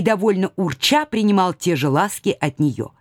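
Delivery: ordinary delivery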